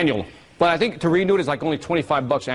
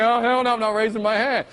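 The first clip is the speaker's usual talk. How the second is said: higher-pitched comic refusal; balking audience member; 7 semitones higher than usual. Retold or imitated. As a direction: imitate a listener digging in. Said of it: "You're like".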